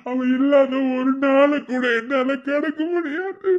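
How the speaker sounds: a husband sobbing for his dead wife; anguished crying. Acted. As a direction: howl out the grief. Grieving husband breaks down.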